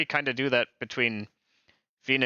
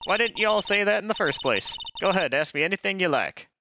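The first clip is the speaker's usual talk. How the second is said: put-on Southern accent; 6 semitones higher than usual. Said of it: "I'm not the best at doing accents".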